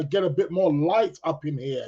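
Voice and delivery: ordinary talk, nothing marked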